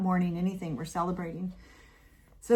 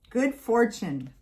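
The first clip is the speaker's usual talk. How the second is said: ordinary talk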